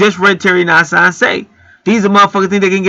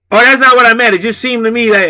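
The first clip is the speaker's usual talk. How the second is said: mock apologetic customer; 6 semitones higher than usual. Mock the customer suddenly apologizing, high and whiny. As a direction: act out the customer caving. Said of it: "then he all apologetic and shit".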